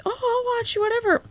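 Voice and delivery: high mock casual viewer voice. Streamer mocking what lazy viewers say, high and mock casual. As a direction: lightly mimic a dismissive viewer. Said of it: "A lot of people do s".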